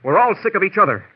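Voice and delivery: loud, high outburst; he's had enough of all of them; exasperated shout. Acted. Doctor loses his temper at the bickering guests, loud and high.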